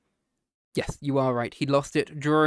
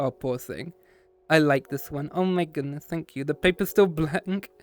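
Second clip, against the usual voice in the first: usual speaking voice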